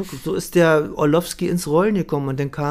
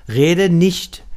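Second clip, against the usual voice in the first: forceful command; 4 semitones higher, 6 dB louder than usual. Retold or imitated. Director insists, cutting him off.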